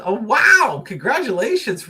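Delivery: loud, bright-eyed burst of delight; excited congratulations. Real, not acted. Thanking Michelle for the donation, excited. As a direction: cheer the donor on with real joy.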